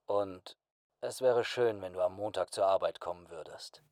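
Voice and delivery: soft, hushed boss voice; gentle request. A narrator's reading. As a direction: ask it quietly, almost tentatively. Boss gently asks after weak smile. (leise, behutsame Bitte)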